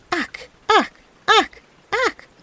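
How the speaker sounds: high croaking, rising; frogs crying out. A narrator's reading. Frogs crying out over his counting, high croak. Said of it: "and cried out".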